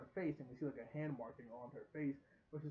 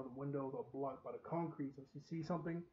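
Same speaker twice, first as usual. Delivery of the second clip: ordinary talk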